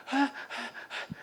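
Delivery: high breathless panting; out of breath. Retold or imitated. Boy panting after running to the riders. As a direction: gasp like a boy who ran far.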